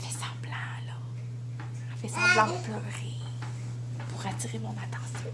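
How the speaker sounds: unvoiced whispered aside. Real, not acted. Mom whispers to viewers that her toddler is faking tears. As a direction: whisper it so she won't hear. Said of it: "whispering aside about daughter".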